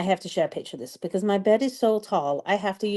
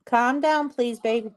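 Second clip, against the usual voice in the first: calling out; 7 semitones higher, slower than usual. Real, not acted. Mom calling out to yelling Alyssa, drawn-out and pleading. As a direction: call across the house to calm her.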